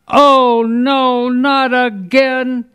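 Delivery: high, slow, drawn-out dismay; exasperated thought. Acted. TV viewer finds only reruns, hammed-up exasperation. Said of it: "All he is thinking is".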